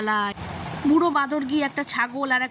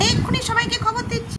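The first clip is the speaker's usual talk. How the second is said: much higher chirpy bird voice; hurrying off to spread news; eager little bird; 11 semitones higher than usual. Acted. (অনেক উঁচু গলায় ব্যস্ত পাখি)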